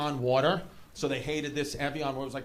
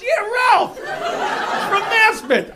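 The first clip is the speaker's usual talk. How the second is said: high, booming local-guy reply; loud admission; 11 dB louder, much slower than usual. Acted. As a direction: drop the act and shout it out, drawn out at the end. Umpire drops fake accent, admits name.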